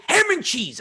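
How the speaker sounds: high, loud mock shout; retold angry demand; furious father. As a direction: yell it as a fed-up dad.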